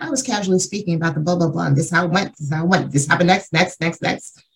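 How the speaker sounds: rushed casual rattle. Retold or imitated. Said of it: "the entire joke was just like".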